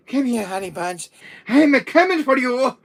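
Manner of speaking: high, loud amorous holler; put-on lovesick voice, rising to a shout; lovestruck pursuer. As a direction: call out to your sweetheart as you chase them. Potioned brother chasing his sweetheart.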